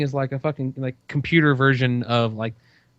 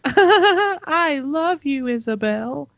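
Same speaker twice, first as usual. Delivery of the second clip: high sweet toy voice; cute doll voice; much slower than usual. Retold or imitated. Father imitates daughter's teddy bear, high and sweet. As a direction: squeak it out like a talking teddy. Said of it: "you hear this".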